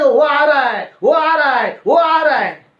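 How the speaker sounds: imitating her alarmed shrieking chant; frantic warning. Possessed woman warning the family the pastor is coming, high alarmed cry. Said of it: "ऐसा बोल रही थी".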